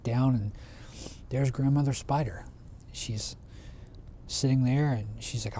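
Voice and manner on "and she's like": ordinary delivery, nothing marked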